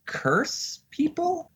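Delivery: high, slow, doubtful question; unsure high question. Real, not acted. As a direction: toss out a hopeless guess.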